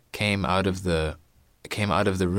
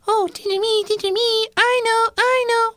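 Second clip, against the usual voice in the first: put-on squeaky very high child; girl in the back; 6 dB louder than usual. Girl in the back squealing for the teacher, very high. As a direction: squeal to be picked. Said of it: "one girl was in the back".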